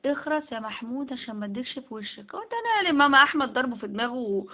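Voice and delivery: usual speaking voice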